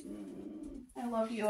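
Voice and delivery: tender. Real, not acted. Tender thanks to friend.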